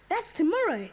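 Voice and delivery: ordinary delivery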